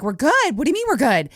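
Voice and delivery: high-pitched incredulous echo; retold disbelief. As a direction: throw his words back, baffled. She echoes driver in disbelief.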